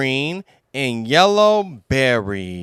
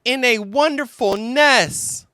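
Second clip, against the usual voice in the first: put-on high small kangaroo child; little Roo voice; 12 semitones higher than usual. Roo adding to the list, high and eager. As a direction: chime in sweetly, much higher. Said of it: "said Roo".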